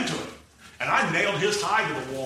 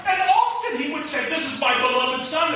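preaching at full force; 12 semitones higher, 5 dB louder than usual. Acted. Preacher thundering to the congregation, at full force. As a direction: drive each phrase home from the pulpit.

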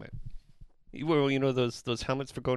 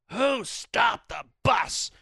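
higher, louder, very slow indignant bark; angry tour manager; 6 semitones higher, 4 dB louder, much slower than usual. Retold or imitated. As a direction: hammer out each word like a furious boss.

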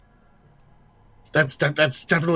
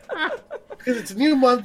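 laughing; 9 semitones higher, slower than usual. Real, not acted. Laughing through announcement.

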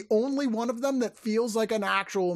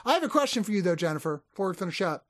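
normal talking voice

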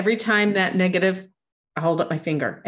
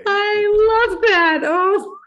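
high, slow, delighted; delighted squeal; 6 dB louder, much slower than usual. Real, not acted. Delighted reaction, much higher.

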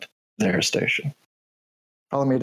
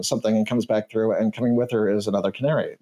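usual speaking voice

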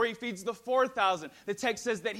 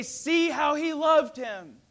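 slow, louder, higher call; crowd exclaiming; 6 semitones higher, 7 dB louder, slower than usual. Acted. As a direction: proclaim it in wonder. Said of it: "the crowd see it and they say".

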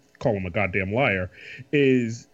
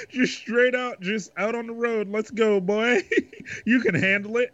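jokey dad voice; 11 semitones higher than usual. Retold or imitated. Father voiced letting son drive.

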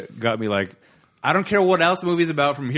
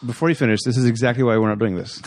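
ordinary delivery

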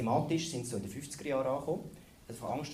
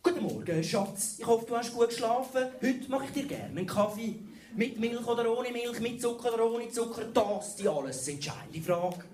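high, pompous newsroom voice at breakfast; TV reporter voice; 9 semitones higher, 3 dB louder than usual. Acted. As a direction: report on the coffee like breaking news. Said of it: "reden die auch im Privatleben so?".

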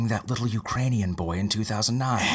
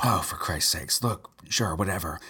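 ordinary talk, nothing marked

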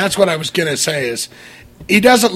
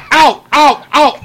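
a lightly voiced, higher yelping ow ow ow; hint of a Horshack yelp, a bit higher and louder; 8 semitones higher, 5 dB louder, slower than usual. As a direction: yelp like a goofy sitcom kid. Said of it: "And Horshack".

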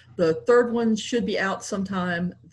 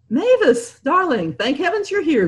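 higher relieved George; 8 semitones higher, 3 dB louder than usual. A narrator's reading. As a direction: greet her with relief.

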